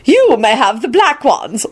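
high, slow haughty announcement. A narrator's reading. Lady Fanshawe claiming her share of the men, haughty. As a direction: announce it with grand disdain. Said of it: "Lady Fanshawe announced haughtily".

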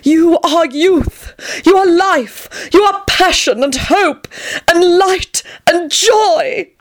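very high, slow, swooning rhapsody; enthused. A narrator's reading. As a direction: build a drawn-out hymn of adoration. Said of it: "Tony enthused".

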